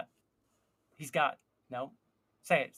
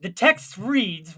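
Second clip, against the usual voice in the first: normal talking voice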